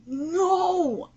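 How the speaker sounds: drawn-out protesting "no"; real exasperated protest; indignant objection. Real, not acted. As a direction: cut in with an outraged no. Interrupts reading to object.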